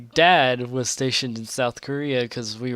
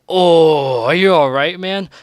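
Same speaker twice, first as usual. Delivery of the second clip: loud, higher cry of worry; concerned kids calling out; 4 semitones higher, 9 dB louder than usual. Retold or imitated. Kids on the playground shout to Jimmy, loud and worried. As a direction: call out in alarm to the hurt boy. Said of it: "I just hear everyone just go".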